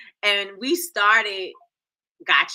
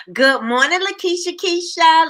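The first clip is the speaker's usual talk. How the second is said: excited greeting; 7 semitones higher, 8 dB louder than usual. Real, not acted. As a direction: sing out the viewer's name in welcome. Greeting a viewer by name.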